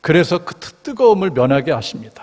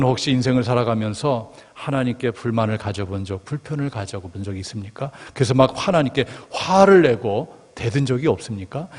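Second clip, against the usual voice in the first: ordinary talk, nothing marked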